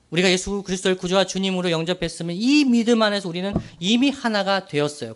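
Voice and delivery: ordinary delivery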